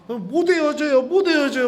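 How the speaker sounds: high, loud pleading cry; tearful plea. Retold or imitated. Sister-in-law crying to the priest, pleading. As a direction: begs through tears not to break up. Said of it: "이러면서 막 우는 거예요".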